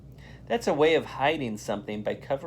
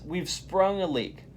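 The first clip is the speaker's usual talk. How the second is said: a higher, mild cry of alarm; alarmed shout; 6 semitones higher than usual. A narrator's reading. Arnold warning the class of a leak, mild alarmed shout. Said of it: "Arnold shouts".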